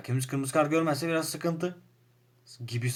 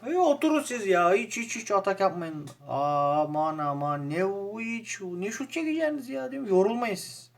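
sarcastic; 7 semitones higher than usual. Real, not acted. Mocking his passive team.

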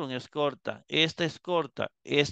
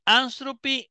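anstropy stressed on the first syllable, AN; stress on antepenult; 10 semitones higher, slower than usual. Shown as a demonstration. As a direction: hold the accent on AN in anstropy. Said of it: "ahí se sigue manteniendo en la". (anstropy con el acento en la primera sílaba, AN)

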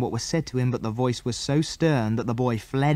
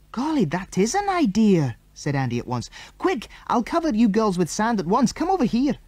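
higher, eager and urgent; excited; 9 semitones higher than usual. A narrator's reading. Andy to the girls, eager and urgent. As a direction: seize the idea and rush.

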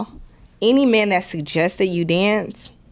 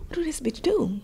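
whispered gossip; 6 semitones higher, 9 dB quieter than usual. Retold or imitated. Curious people gossiping about her job, whispered. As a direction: whisper it like a nosy onlooker.

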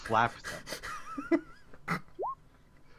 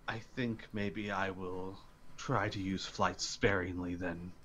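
sheepish quiet; 6 semitones lower, 10 dB quieter than usual. Acted. Sky speaks sheepishly after landing.

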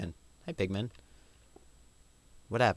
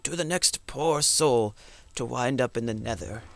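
storyteller voice; 2 semitones higher, 3 dB louder than usual. Pretend. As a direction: read the dead man's note solemnly. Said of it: "I'm gonna make a storyteller voice".

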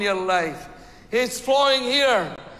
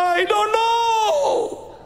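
mock clueless excuse; 13 semitones higher, much slower than usual. Mocking someone's clueless excuse.

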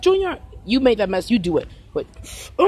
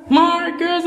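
put-on kid voice; 8 semitones higher, 3 dB louder than usual. Child adoring his markers.

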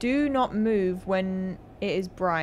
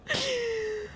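high shaky sigh; 11 semitones higher, 5 dB quieter than usual. Real, not acted. After scare.

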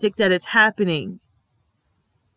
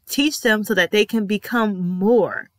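ordinary talk

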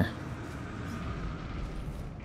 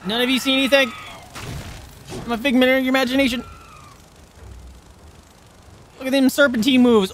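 much higher, fast, giddy teasing; gleeful taunting; 10 semitones higher, faster than usual. Real, not acted. Player sneaking past enemies, giddy and gloating.

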